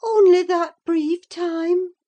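high, loud astonished question; incredulous outcry; exclaiming. A narrator's reading. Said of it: "she exclaimed".

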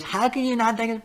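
high, put-on scolding voice; an appalled dinner guest, hammed up; indignant friends. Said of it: "everyone goes".

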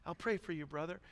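soft, low mock-sympathetic pastor voice; meek, mild pastor. Mocking how people expect pastors to console someone, meek and mild. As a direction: imitate a meek pastor, hushed and sinking low. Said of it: "pastors are meek and mild".

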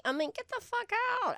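high, indignant outburst; mock outrage. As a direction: play it up as outraged. Mock outrage about royals.

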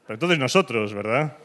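ordinary delivery

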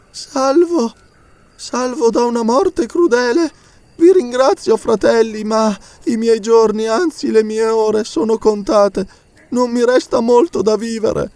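put-on high, slow, frail old missionary; weak dying priest. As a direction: speak as a man near death. Dying missionary speaks weakly. (il missionario morente ringrazia, voce debole e lenta)

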